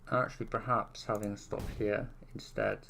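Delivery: muffled behind a hand; hand over mouth. Real, not acted. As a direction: talk through your hand over your mouth. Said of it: "I've got my hand over my mouth".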